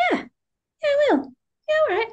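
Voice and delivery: high, giddy rush of yeses; excited yes. Retold or imitated. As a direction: blurt yes in happy disbelief. Accepting the proposal retold.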